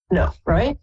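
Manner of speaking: ordinary delivery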